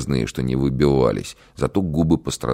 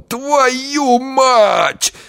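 pained shout; 5 dB louder than usual. Acted. Bitten man curses loudly.

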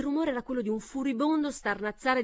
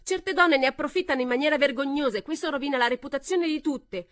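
loud, higher indignation; angry rebuttal; 6 semitones higher, 6 dB louder than usual. A narrator's reading. Pamela condemning such women, loud. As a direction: condemn them hotly.

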